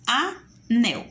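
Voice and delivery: "anel" split A - NEL with a clear pause; teacher's two-beat "a / nel"; syllable by syllable. Shown as a demonstration. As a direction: break "anel" into two separate beats, a... nel. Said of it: "Duas sílabas".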